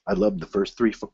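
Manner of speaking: normal talking voice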